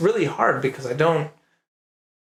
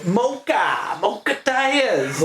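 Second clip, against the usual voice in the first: mock Maine accent; 8 semitones higher, much slower than usual. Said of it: "You know".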